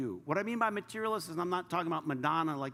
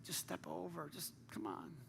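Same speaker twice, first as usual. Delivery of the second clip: much softer, half-whispered plea; soft pleading; 13 dB quieter than usual. Retold or imitated. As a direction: gently plead through tears. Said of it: "Jesus is weeping. It's like".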